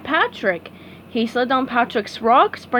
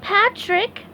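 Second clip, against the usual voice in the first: SpongeBob voice; 10 semitones higher than usual. A narrator's reading. SpongeBob to Patrick.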